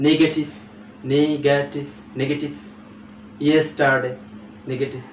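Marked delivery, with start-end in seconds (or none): none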